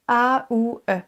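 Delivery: A, ou, E sounded one by one; three distinct vowels A ou E; separated vowels. Shown as a demonstration. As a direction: spell out the glide A ou E. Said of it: "trois sons qui se suivent".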